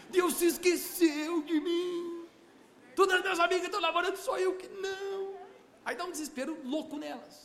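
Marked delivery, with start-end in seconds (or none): 0.1-5.3 mock despair. Desperate woman's thoughts voiced.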